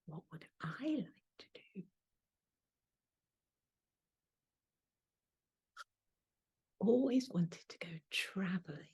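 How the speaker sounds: soft, whispered inner voice; whispered thought. Real, not acted. Narrator voicing her private wish to travel, whispered. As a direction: whisper it to yourself.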